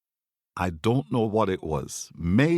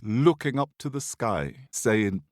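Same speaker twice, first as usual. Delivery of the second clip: usual speaking voice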